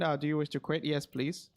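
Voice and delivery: ordinary talk, nothing marked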